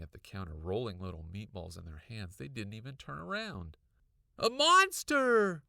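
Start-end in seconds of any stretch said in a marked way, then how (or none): 4.4-5.6 higher, louder, slow scream; screaming it again at her parents. A narrator's reading. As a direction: shriek it with all you've got. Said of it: "I screamed again".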